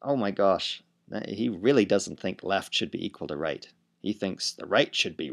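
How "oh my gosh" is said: normal talking voice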